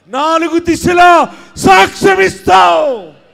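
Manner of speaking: high, loud, rising sermon cry; fiery preaching. Acted. Pastor prophesying to the congregation, preaching at full force. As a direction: build the crowd up to a roar.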